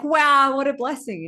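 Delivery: hammed-up wonder; delighted villagers. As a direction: cheer like neighbours at good news. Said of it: "everyone's like".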